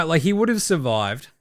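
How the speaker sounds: normal talking voice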